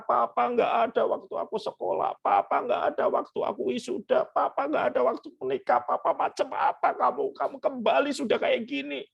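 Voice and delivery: high, rising accusing son; mock angry outburst. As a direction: pile up each charge against the father. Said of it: "dia gebrak meja dan berkata".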